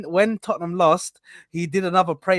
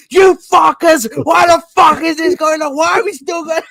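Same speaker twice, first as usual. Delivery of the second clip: high, loud raging yell; retold furious yelling; 10 semitones higher, 7 dB louder than usual. Retelling a friend raging at the second goal, loud furious shout. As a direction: bellow it at the screen like a fan losing it. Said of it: "He was going crazy".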